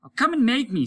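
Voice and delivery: high, loud mocking taunt. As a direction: goad him with a sneer. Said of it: "He was laughing easily".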